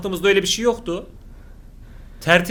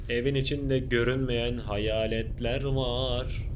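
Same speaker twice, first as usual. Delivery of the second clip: slow, softer ghost-story voice; spooky hush; 7 semitones lower, 8 dB quieter, much slower than usual. Pretend. Youtuber teasing viewers, slow and hushed. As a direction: lean in and tell it like a campfire tale.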